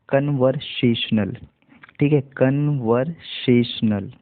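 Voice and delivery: "conversational" said slowly, syllable by syllable; slow careful pronunciation. Shown as a demonstration. Teacher slowly models "conversational" for students. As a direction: stretch out "conversational" so learners catch every part. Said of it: "ध्यान से सुनिएगा".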